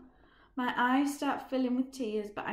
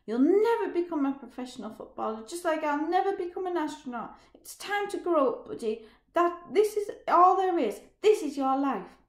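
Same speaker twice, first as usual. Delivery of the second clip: bitter shout; 7 semitones higher, 9 dB louder than usual. Acted. Roger shouting during the fight.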